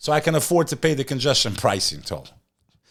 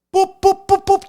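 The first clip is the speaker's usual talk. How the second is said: beeping noise; 6 dB louder than usual. Retold or imitated. Imitating check-in beeps.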